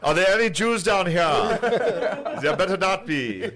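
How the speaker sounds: loud, high-pitched comic villain; mock menacing demand; mock Nazi submariner. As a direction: call it out like a hammed-up sailor. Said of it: "bunch of Nazis in submarines being like".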